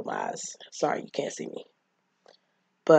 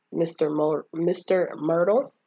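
ordinary delivery

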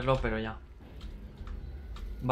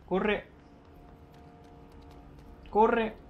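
urgent shouting; 7 semitones higher, 5 dB louder than usual. Real, not acted. Urgent in-game run command.

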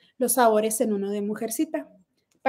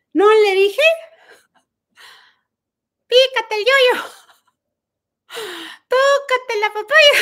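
high, mocking voice with a teasing lilt; mocking singsong retort; 9 semitones higher, 6 dB louder, slower than usual. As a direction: taunt her in a high silly voice. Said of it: "Yo le decía". (burla aguda y cantarina)

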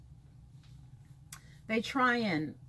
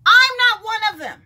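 I'm punched high and loud, a flat refusal; firm loud refusal; 9 semitones higher, 10 dB louder than usual. Real, not acted. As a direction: say it loud and final, no debate. Emphatic refusal, repeated.

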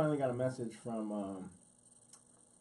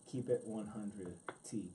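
low, slow, soft voice; a hint of a laid-back Machete; 5 semitones lower, 8 dB quieter, slower than usual. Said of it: "in the Machete voice".

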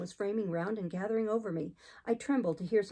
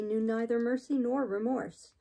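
ordinary delivery

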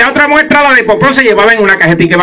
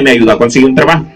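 ordinary delivery

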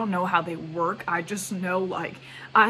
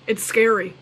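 usual speaking voice

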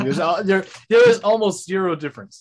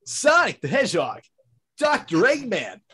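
Sonic impression; 6 semitones higher than usual. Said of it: "Yours sounds, like, super good".

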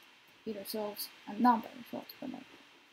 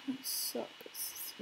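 disappointed whisper; 7 dB quieter than usual. Real, not acted.

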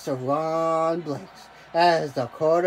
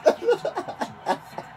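high breathy laugh. Real, not acted. Gamer laughing at own game.

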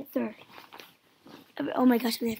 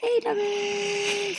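cooing; 8 semitones higher, much slower than usual. Real, not acted. Cooing at pet in game.

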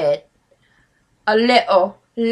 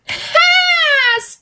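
shouted laugh. Real, not acted. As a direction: howl at the punchline. Cracking up at the lyric.

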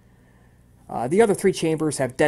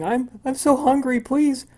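put-on high, pleading child's sob, louder; crying boy; 10 semitones higher, 6 dB louder than usual. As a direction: beg through tears like a starving little boy. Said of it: "He's crying".

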